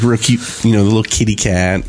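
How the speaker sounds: ordinary talk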